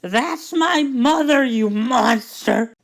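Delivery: high, loud, slow furious scream; girl yells. Retold or imitated. Cassidy screaming at the trooper holding her mother, furious. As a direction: scream it at the man threatening her mom. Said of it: "and she yells".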